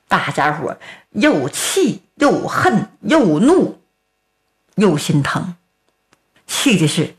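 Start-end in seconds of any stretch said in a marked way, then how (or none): none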